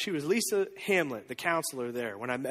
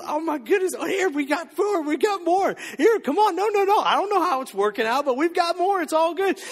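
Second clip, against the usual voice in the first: high, louder, giddy with amazement; excited disciples; 9 semitones higher, 4 dB louder than usual. Retold or imitated. Disciples urging the crowd to take more, thrilled and loud. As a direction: burst out thrilled as the food keeps coming.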